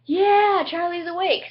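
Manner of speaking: high, loud happy cheer; celebrating that the patient woke up; excited cheer. Retold or imitated. Friends celebrating Charlie waking, excited high cheer.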